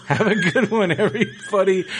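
laughing. Real, not acted. Sign-off with a laugh.

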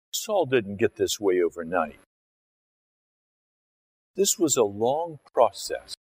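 ordinary delivery

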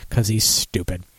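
usual speaking voice

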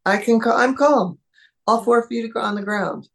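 the dog proudly settling down; relieved dog voice. Pretend. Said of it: "and then recognize".

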